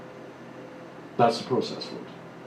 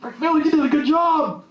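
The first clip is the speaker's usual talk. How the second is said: bright, much higher voice of someone handing out praise; a cheery pat on the back, pitched way up; praising voice; 12 semitones higher than usual. Retold or imitated. Speaker imitating an approving outsider, high and cheery. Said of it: "somebody says".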